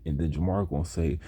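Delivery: usual speaking voice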